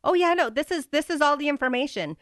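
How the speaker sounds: mock innocent. Mocking Harry's casual excuse.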